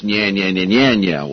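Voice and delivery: lightly imitated nyah-nyah taunt; Rush Limbaugh. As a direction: chant a mild mock gloating jingle. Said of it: "if I were Rush Limbaugh".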